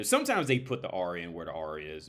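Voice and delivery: ordinary delivery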